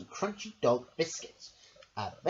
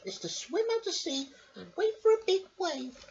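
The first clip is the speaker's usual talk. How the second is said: much higher, squeaky little lady voice; Missus Armitage voice. Acted. Missus Armitage asks her dog a question, high and squeaky. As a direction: pipe it up like a fussy little lady. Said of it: "said Missus Armitage".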